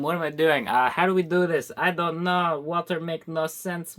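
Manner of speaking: ordinary talk, nothing marked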